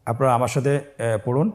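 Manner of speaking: ordinary talk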